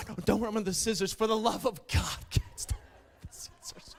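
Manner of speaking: gasping, frantic crazy teacher; hammed-up horrified teacher; mock panicked teacher. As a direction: plead like the scissors are deadly.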